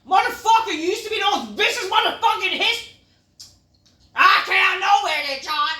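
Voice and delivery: high, loud shouting rant; furious rant. Real, not acted. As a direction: yell in outrage. Ranting at Orton's change.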